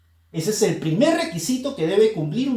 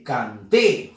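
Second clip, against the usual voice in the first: slow "canté", weight on "té"; stress on last syllable; 7 semitones lower than usual. Shown as a demonstration. Teacher repeating where the force falls, stress on "té". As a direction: stretch out "canté", landing on "té". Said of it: "fuerza de voz T".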